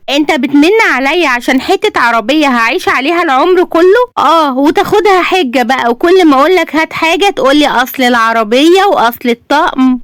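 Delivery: high-pitched, aggrieved wife; indignant nagging wife. A narrator's reading. Wife reproaches husband over the car, indignant and high. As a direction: complain high and insistently at your husband.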